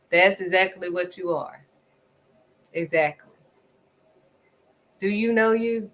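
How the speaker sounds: usual speaking voice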